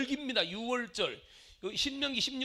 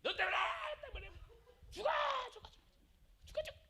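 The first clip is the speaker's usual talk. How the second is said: high, softer strained blaming; mock venting; 5 dB quieter, much faster than usual. Preacher imitating someone blaming over one grievance, mock fury. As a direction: act out a grudge in repeated little bursts.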